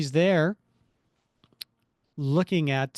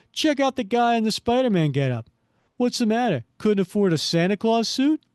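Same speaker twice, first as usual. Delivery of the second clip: mocking thug; 8 semitones higher, 5 dB louder than usual. Thug mocks Spider-Man's costume.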